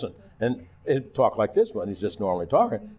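ordinary talk

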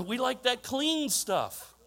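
normal talking voice

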